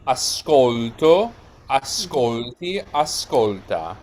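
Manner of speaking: ascolto, ascolti, ascolta, each slow with weight on SCOL; stress on middle syllable. Shown as a demonstration. Teacher showing where singular forms take stress, slow middle-syllable emphasis. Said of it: "The stress is on here in the middle syllable".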